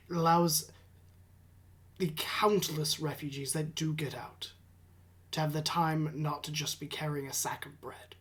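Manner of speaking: quietly threatening advisor; stern advisor whisper. Acted. Advisor NPC speaks.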